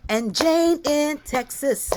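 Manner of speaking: ordinary talk, nothing marked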